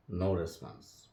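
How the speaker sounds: ordinary delivery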